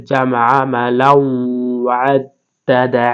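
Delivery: slow chant, nasal hum held at the end of "মালাউ"; drawn-out recitation with a nasalised join before "ওয়াদ্দাদা"; ghunna. Shown as a demonstration. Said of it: "আর এখানে গুণনা করতে হবে".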